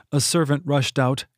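ordinary delivery, nothing marked

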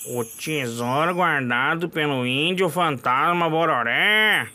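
mock ominous legend voice; spooky low echo. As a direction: let the legend rumble slowly. Echoing the legend again.

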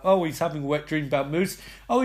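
usual speaking voice

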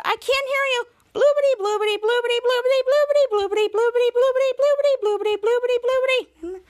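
high singsong babble, run on without stopping; mock refusal to listen, blabbering over him; childish drowning-out. Retold girl drowning out speaker.

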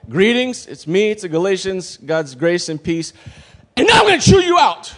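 high chirpy greeting exploding into a loud telling-off; from formal hello to high, booming rebuke; mock angry Paul. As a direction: greet them, then yell the scolding. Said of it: "He's just like".

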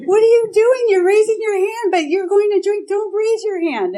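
shrill alarm at your own hand going up; frantic inner voice. Retold or imitated. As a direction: her inner voice begs her not to raise her hand, high and loud. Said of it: "Like, like".

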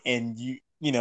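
ordinary talk

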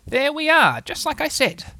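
high, pleased told-you-so; proudly self-satisfied. Acted. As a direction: announce success with a touch of smugness. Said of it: "he whispered proudly".

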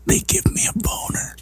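hushed, breathy whisper. Real, not acted. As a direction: whisper it like a secret. Dirty punchline whispered to partner.

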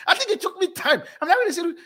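giggly high retelling; laughing. Real, not acted. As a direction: laugh through the words.

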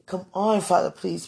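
ordinary talk

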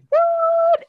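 very high, loud mock-shocked yelp; shocked and appalled. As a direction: squeal it like an appalled onlooker. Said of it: "Emmett's all shocked and appalled".

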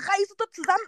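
high-pitched retold reprimand; telling-off. Friend retelling her pep talk to the groom, telling-off. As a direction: replay giving him a firm talking-to. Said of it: "noch eine Ansage gemacht".

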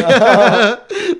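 high loud burst of laughter; real cackle at own joke; hearty laugh. Real, not acted.

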